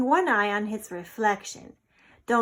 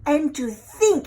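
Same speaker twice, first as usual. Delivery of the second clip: hissed; 6 semitones higher, 9 dB louder than usual. A narrator's reading. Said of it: "he hissed".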